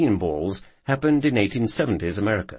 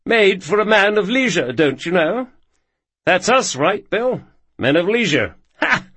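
high, louder mock-posh English accent; fake posh accent; 10 semitones higher, 6 dB louder than usual. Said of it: "Adopts a fake posh accent".

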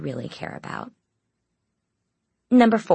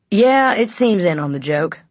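louder, lightly voiced guard on the radio; 3 semitones higher, 6 dB louder than usual. A narrator's reading. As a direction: answer the radio in a raised, amused voice.

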